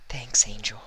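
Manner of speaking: hushed, tearful whisper; mumbled thanks. A narrator's reading. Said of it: "she mumbled".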